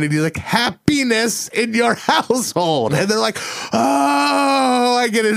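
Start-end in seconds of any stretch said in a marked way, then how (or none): 0.4-2.9 slow, higher put-on French accent; mock French accent. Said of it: "talking like French guys". 3.7-5.4 high, surprised "oh, I get it"; mock sudden realization. Quoted realization in story.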